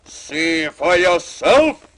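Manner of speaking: loud, very slow angry roar; furious ogre roar. A narrator's reading. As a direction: roar it in fury. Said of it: "roared the furious ogre".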